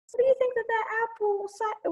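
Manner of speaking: ordinary delivery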